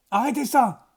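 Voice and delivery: ordre crié plus haut et plus fort; crie-le pour faire taire la flûte; cri. A narrator's reading. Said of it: "cria immédiatement Broxholm". (cri furieux)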